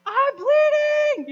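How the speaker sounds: very high, drawn-out shriek imitating a hurt child; loud, hammed-up wail; screaming child. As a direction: scream it like a panicked little girl. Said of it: "the screaming and the crying".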